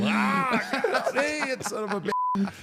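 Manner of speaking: imitating Coco cackling at home over the footage; mock gleeful Coco. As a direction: gloat while laughing.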